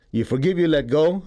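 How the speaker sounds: usual speaking voice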